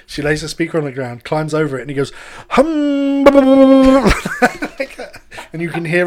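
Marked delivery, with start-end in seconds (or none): 2.5-3.3 high loud mock humming; high loud hum. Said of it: "he's just humming".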